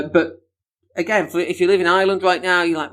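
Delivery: ordinary delivery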